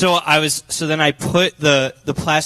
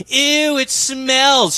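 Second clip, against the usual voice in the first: higher, mock five-year-old going ew; little sister disgust; 8 semitones higher, much slower than usual. Imitating his five-year-old sister disgusted by the smell, higher mock whine. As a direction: squeal it like a grossed-out little kid. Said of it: "my sister going".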